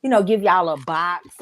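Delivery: ordinary talk, nothing marked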